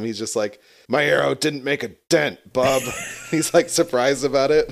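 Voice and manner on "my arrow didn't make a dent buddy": a gruff Wolverine voice, lightly done. Retold or imitated. Hawkeye in Wolverine's voice, shrugging off the arrow, lightly imitated. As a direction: toss off the line like a grizzled loner, mildly put on. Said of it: "Wolverine's voice as Hawkeye".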